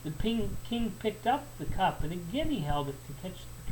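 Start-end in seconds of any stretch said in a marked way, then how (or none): none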